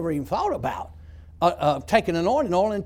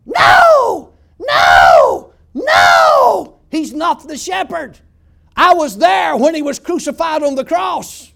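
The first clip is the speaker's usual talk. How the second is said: shrieking demon voice, much higher and much louder, slowing at the end; demon screaming; 10 semitones higher, 15 dB louder, much slower than usual. Retold or imitated. Preacher voicing the demon fighting the Psalm, shrieking shout. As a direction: scream it like a tormented demon defying him. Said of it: "that demon started screaming".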